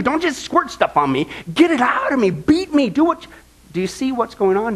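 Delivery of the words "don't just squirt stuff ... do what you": urgent plea. Retold or imitated. David begging God, rising and urgent. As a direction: push the plea higher each line. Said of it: "Now he's saying".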